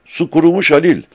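very high, loud alarmed cry; shouting. A narrator's reading. Mehmet finds the riverbed dry and calls his companion, shouting. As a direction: shout the bad news across to him. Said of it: "diye bağırdı".

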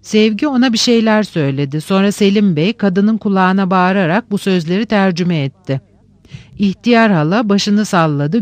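plain reading voice

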